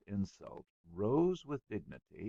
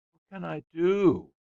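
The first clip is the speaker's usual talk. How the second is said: high, loud, whining plea; plaintive; 10 semitones higher, 13 dB louder than usual. A narrator's reading. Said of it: "he said plaintively".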